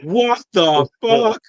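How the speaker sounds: high, stretched-out disbelieving yell; stunned scream. Retold or imitated. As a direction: scream it at the TV in shock. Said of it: "you just hear me screaming".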